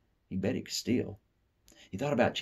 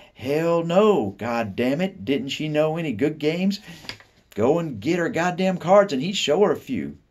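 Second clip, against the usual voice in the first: high, loud, slow swaggering curses; cocky cursing boy; 10 semitones higher, 7 dB louder, slower than usual. Retold or imitated. Boy imagines cursing at grandmother, high loud swagger. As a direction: shock her with every swear. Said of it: "he'd say".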